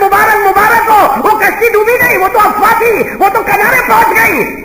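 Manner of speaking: joyful news. Retold or imitated. Messenger brings good news.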